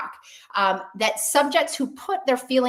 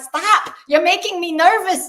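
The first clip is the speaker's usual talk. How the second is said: high, loud agitated protest; mock-nervous movie character; hammed-up plea, pitched way up; the other guy; 9 semitones higher, 7 dB louder than usual. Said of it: "And then the guy's like".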